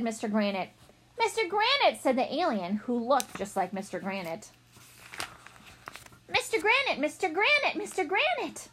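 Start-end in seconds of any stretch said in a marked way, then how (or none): 1.2-2.0 joyful reunion cry. Acted. Emotional alien reunion greeting. 6.3-8.7 overjoyed greetings. Acted. Aliens hugging and crying.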